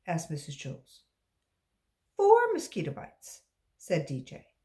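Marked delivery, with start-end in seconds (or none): 2.2-3.4 put-on high, bright answering kid; eager child. A pupil answering the teacher, high and loud. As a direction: blurt the answer proudly.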